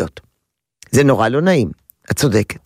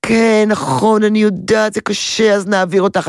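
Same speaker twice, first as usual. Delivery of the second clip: הזדהות מוגזמת; 7 semitones higher than usual. Acted. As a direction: overdo the agreeing, stretched and raised. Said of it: "אני גם לא אומרת". (הזדהות מעושה, גבוהה ומשוכה)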